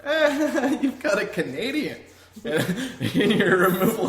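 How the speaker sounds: high, loud mock discovery with a laugh; imagined searcher. Searcher finding someone in the truck, jokey high exclamation. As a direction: call it out laughing, as if finding a stowaway. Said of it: "it's just like".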